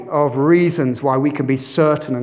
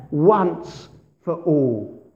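Once for all drawn out very slowly; Once stretched long and falling; slow emphasis; much slower than usual. Shown as a demonstration. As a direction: linger on each word of Once for all. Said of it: "Do you see that little phrase there?".

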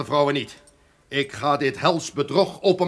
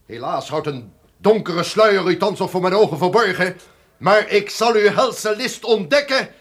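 much higher and louder, a raging threat; furious shout; 11 semitones higher, 9 dB louder than usual. Acted. The duke challenges the unseen voice, shouting in fury. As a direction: hurl the vow of vengeance into the dark.

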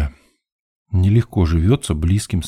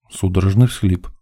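ordinary delivery, nothing marked

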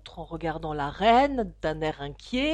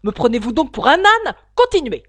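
very loud, indignant king; indignant cry, then high loud command; sharp shout; 4 semitones higher, 13 dB louder than usual. A narrator's reading. The king scolds the hatter, shouting sharply. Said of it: "cria le roi vivement".

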